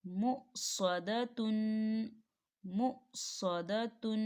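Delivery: मुसदतुन read very slowly, हमज़ा not stretched; slow recitation of मुसदतुन. Shown as a demonstration. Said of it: "अगली मिसाल को देखें".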